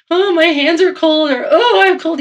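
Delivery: whiny complaint. Retold or imitated. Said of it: "if we're like".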